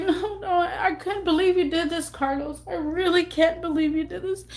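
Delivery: a wounded plea; hurt disbelief. Retold or imitated. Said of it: "I was like".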